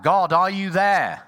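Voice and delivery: loud, high, slow cry for help; shouted call. Retold or imitated. Man hanging from a cliff calls to God, loud and high. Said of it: "And he shouted out".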